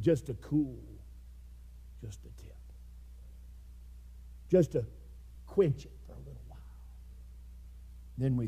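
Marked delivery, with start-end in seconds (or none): 0.0-6.6 whispered, parched begging; barely able to ask for a drop; faint plea. Acted. Rich man's faint plea for water.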